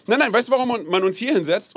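ordinary talk

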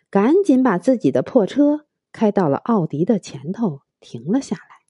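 plain reading voice

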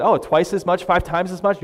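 ordinary delivery

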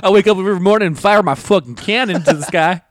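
loud, high mock gun-nut bragging; blustering boast. Mocking gun owner with a cannon, blustering loudly. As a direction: bellow a ridiculous boast.

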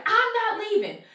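lightly mock the stubborn painter, laughing; mimicking the painter who won't leave, high and laughing. Painter voiced in story.

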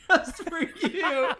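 high, drawn-out words through laughter; helpless laughing. Real, not acted. Host cracking up at their own wheelchair-friend punchline, helpless laughing. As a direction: crack up while saying it.

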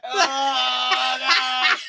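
retching, about to throw up; gagging. Real, not acted. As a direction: choke the words out while gagging. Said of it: "I'm gonna yak".

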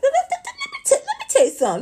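high squealing laugh through the words; laughing mock scolding. Laughing at Tamar, squealing mock scold. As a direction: cackle while scolding a reality star.

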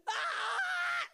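high cry out loud; emotional cry. Real, not acted. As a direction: let out a sudden high cry. Emotional cry after hymn.

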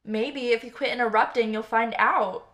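loud angry bartender yell. Acted. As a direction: yell back to shut her up. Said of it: "the bartender yelled".

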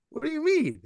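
high incredulous retold question; imitating disbelief. Telling how he answered his dad, high mock surprise.

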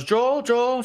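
high-pitched urgent call; imitating Ellie. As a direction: imitate calling a sleeper's name, pitched high. Acting out Ellie waking Joel.

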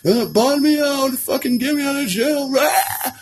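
high-pitched hammed-up wail; mock crying. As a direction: imitate your old self-pitying cry to get out of jail. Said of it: "The way I'm crying".

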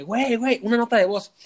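high, pressing urgency; urgent prodding. Retold or imitated. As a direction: shake him awake with it. Urging drunk friend to record. (apurado, insistente)

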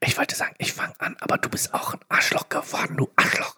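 angry whisper; whispered bickering. Pretend. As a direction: argue in a whisper. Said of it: "Aber flüsternd".

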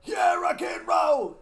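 rock shout. Retold or imitated. Fans imitating [name]'s yell, high and loud. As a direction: belt it like a rocker. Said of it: "where he's like".

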